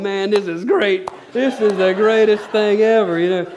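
high, loud, slowed-down glee; reliving gleeful amazement in the audience; retold delight. Own delighted reaction, retold.

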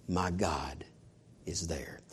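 hushed unvoiced whisper. Real, not acted. Preacher whispering to the congregation for effect. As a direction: breathe it out in a hush. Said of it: "preacher drops to whisper".

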